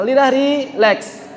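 usual speaking voice

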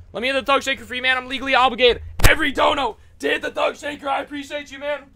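high-pitched, playful streamer patter; hyped donor thanks. Real, not acted. Performs donor thanks routine.

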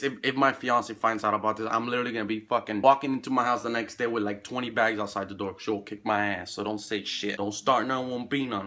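usual speaking voice

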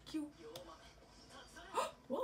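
normal talking voice

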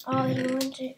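ordinary delivery

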